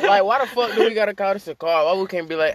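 excited. Real, not acted. Excited rant about words.

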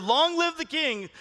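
high, drawn-out mock cheer; crowd's acclaim. People of Israel hailing their new king, mild cheer. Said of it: "all the people shouted".

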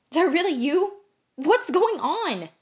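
can hardly believe it; amazed. A narrator's reading. Stunned reunion questions.

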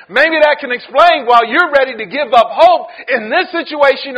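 loud, building sermon cry; preaching at full force. Acted. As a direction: preach it hard to the church.

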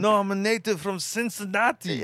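high mock Austrian accent. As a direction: put on a thick Austrian accent.

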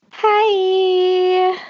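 high, drawn-out podcaster greeting; Karen Kilgariff. Shown as a demonstration. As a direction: stretch out a high-pitched podcast hello. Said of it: "you just sounded like Karen Kilgariff".

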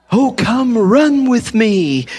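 high, slow, swooning lover's plea; mock romantic voice. Preacher mimics God as a swooning romantic. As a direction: croon it like a lovesick suitor.

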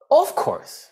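high, clipped of course, falling; mock of course. Shown as a demonstration. Teacher mimics how Americans say "Of course". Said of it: "你一听到of course".

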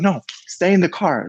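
sharp high command to stay put; imitating the guard's yell; retold yell. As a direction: bark the order at him. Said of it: "he starts yelling".

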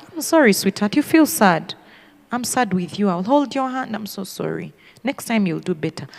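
soft, low motherly comfort; consoling. Acted. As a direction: soothe a sad child gently. Acted consoling of losing child.

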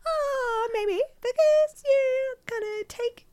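high, very slow mock whine; hammed-up awkward excuse; reluctant whiny excuse. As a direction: drag it out high, as if loath to admit it.

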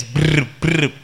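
lip-trilled pager buzz, twice; imitated buzzing. Preacher showing how the food pager vibrates, imitated buzz. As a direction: make two short vibrating brrs. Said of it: "it vibrates".

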